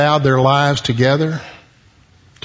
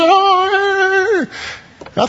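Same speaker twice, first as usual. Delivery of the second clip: happy dance. Acted. Preacher pictures Adam dancing.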